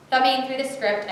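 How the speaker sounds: ordinary delivery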